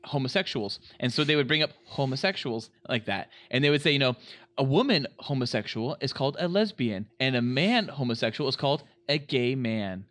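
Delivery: ordinary delivery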